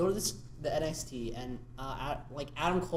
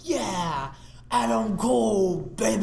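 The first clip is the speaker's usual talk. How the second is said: slow, higher hype call; hyped catchphrase; 5 semitones higher, 4 dB louder, slower than usual. Retold or imitated. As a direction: drag it out like a hype man. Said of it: "He's like".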